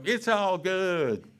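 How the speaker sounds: drawn-out, upbeat and loud it's all good; cheery server reply. Retold or imitated. Barbecue server cheerfully answering a hungry customer. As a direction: sell it like a happy barbecue server.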